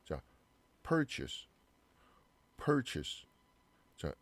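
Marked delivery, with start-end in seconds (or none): 0.8-3.3 "purchase" as PUR-chis; "purchase" with a short, reduced ending; weak second vowel. Shown as a demonstration. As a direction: say "purchase" without chase in it. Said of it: "어 발음으로 바뀌죠".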